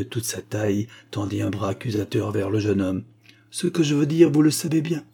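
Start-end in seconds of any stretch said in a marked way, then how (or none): 3.5-5.0 high, loud, stern accusation. A narrator's reading. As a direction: answer severely, pressing every word. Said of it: "répondit-elle sévèrement".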